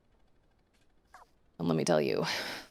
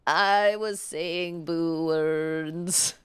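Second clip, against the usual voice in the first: very slow, drawn-out cartoon quote; Simpsons impression; 2 semitones lower, much slower than usual. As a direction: stretch every word like the cartoon. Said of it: "That's a deep Simpsons cut".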